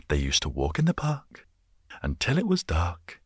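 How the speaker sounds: sing-song high storybook read; kid's book reading. Pretend. Making up a kid's book about a dog, reads it out like a bedtime story. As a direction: read it like a bedtime rhyme for little kids. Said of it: "It sounds like a kid's book".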